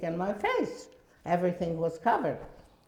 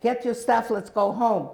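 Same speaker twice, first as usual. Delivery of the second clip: insistent call; 6 semitones higher, 6 dB louder than usual. Retold or imitated. Sister insisting at doorstep.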